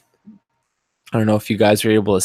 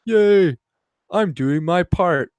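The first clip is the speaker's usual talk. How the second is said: sarcastic fake cheer, higher and louder with a forced laugh; mock cheering; 6 semitones higher, 4 dB louder than usual. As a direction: parody smug applause. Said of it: "They're like".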